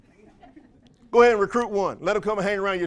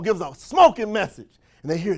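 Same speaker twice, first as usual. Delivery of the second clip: ordinary talk, nothing marked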